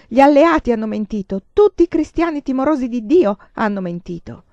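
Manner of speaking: loud, bitter accusation full of despair; hateful outcry. A narrator's reading. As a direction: hurl the accusation, choking with hatred. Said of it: "La sua disperazione era carica d'odio".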